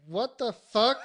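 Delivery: high, loud, indignant disbelief; retold angry outburst. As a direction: re-enact waking up angry and baffled. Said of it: "I was, like, angry".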